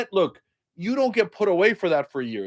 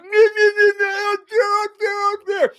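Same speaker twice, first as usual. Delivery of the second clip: much higher, loud panicked denial; frantic Trump caricature; 5 dB louder than usual. Retold or imitated. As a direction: wave off the evidence in a frantic squeal. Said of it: "So he's like".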